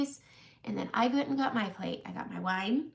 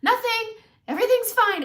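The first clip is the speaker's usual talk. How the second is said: high, loud call back; yelled; 13 semitones higher, 8 dB louder than usual. Retold or imitated. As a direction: yell it to someone indoors. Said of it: "And I just yelled".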